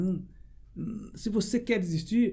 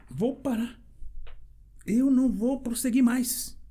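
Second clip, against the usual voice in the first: tearful; 6 semitones higher, slower than usual. Retold or imitated. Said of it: "E chorava que nem uma criança".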